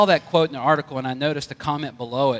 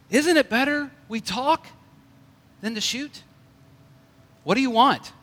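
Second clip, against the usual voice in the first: higher, coaxing bear voice, softly persuasive; soothing bear voice; 8 semitones higher than usual. Acted. Bear coaxing the hunter not to shoot, high and soothing. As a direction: talk the hunter down gently, as a sly bear. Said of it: "spoke softly in a soothing voice".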